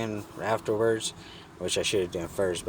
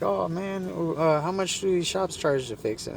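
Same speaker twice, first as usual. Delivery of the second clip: higher-pitched imitation of her; imitated woman; 10 semitones higher than usual. Woman asking about repair prices, imitated higher. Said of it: "And she's like".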